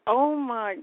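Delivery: high, slow put-on sobbing plea; badly faked tears to fool the cops; fake crying. Woman fakes crying to police officer who pulled her over. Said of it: "put on this crying act".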